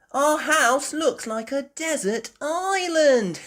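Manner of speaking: high, slow little-pig voice with a giggle; laughing. Acted. Peppa looking at all the water, laughing. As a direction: say it high and loud, then giggle. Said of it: "Peppa laughed".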